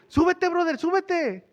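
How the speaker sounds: playful high-pitched pastor imitation; pastor urging. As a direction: call him up like a cheerful pastor. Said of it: "Me dijo".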